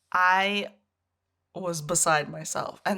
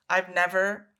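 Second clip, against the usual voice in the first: normal talking voice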